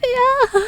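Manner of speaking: a sudden high scream, very loud; piercing scream. A narrator's reading. Narrator voicing the scream Junpei hears, a high loud shriek. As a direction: scream out in terror. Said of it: "A scream".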